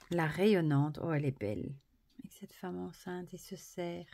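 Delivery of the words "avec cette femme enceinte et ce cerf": softly breathed aside; whispered to herself while admiring. Real, not acted. Whispering about the card as she looks at it.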